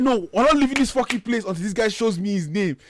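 high-pitched indignant refusal, acted out; retold shouting. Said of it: "So I started shouting".

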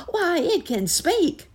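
very high, loud amazement; surprised cry. A narrator's reading. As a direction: exclaim slowly in a high squeal. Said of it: "cried several of the rats in surprise".

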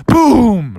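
loud imitated heavy thud; booming footstep. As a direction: boom it out like a giant step.

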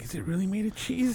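high, soft, innocent question; naive wonder. Retold or imitated. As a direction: ask it like a dazzled kid. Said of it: "Someone's looking at the moon like".

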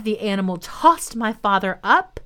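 usual speaking voice

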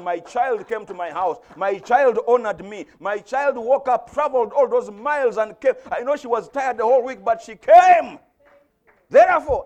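swelling pulpit voice, peaking in a shout; God proud of a child. Acted. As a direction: preach it as God's mounting praise. Preaches as God speaking, building.

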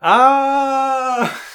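high, loud exclamation of realization; a sudden delighted ah; surprised yelp. Real, not acted. Realizing filler is over.

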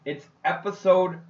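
loud, hearty podcast welcome; show-opening announcer. Acted. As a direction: kick off the show with a big drawn-out hey. Host opens the show.

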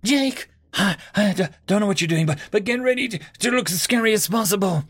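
high, strained, halting shout; shouting through pain. Acted. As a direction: push past the pain and yell. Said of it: "I shouted through the pain".

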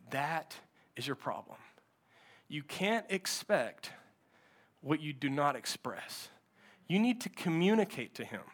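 low, very soft, measured pastor; grave counsel. Retold or imitated. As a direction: counsel her quietly, with weighty pauses. Pastor gently counselling the wife.

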